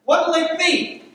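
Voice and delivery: loud, high-pitched New York counterman; deli counterman. Acted. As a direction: bellow for the next order. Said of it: "the counterman in a New York City deli".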